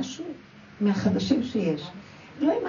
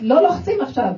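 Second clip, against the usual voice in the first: mock command; 5 semitones higher, 7 dB louder than usual. Quoting the labouring woman.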